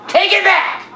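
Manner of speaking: high angry yell. Acted. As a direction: shout it back at her. Second take, pitch jumps.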